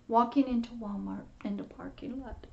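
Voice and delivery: ordinary talk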